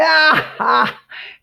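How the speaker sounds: short burst of high cackling; imitated boy's laugh. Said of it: "started laughing".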